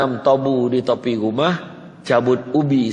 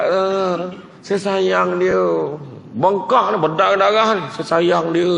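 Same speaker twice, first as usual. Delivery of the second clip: a hint of a pleading wife, raised pitch; beaten wife's voice; 7 semitones higher than usual. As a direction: plead like a battered wife who still loves him. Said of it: "dia kata".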